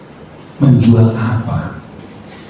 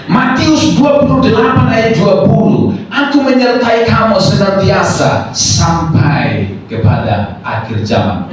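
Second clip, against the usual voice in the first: full-force preaching; 11 semitones higher than usual. Real, not acted. Preacher declaring God's promise to the church, full-force preaching. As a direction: proclaim the promise from the pulpit, high and sustained. Proclaims scripture.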